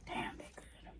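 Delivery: breathy whisper of delight. Real, not acted. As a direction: whisper it like a guilty pleasure. Savoring snack, whispered aside.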